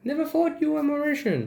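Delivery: high, incredulous mimicry of a commenter; imitated surprised commenter. Mocking a commenter's backhanded compliment, high-pitched disbelief. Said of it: "but it sounds, when you're saying this".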